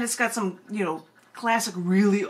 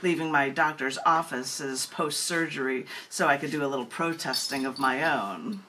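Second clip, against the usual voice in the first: lower, measured poem-reading voice; poetry reading; 5 semitones lower than usual. Acted. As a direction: read it out like a poem on stage. Reading her own poem aloud.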